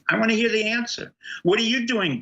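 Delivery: high, insistent questioning; indignant demand. Acted. Guest demanding answers from the governor, indignant. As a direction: press the absent official hard.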